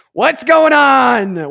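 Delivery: drawn-out mock welcome to the guest; stretched greeting.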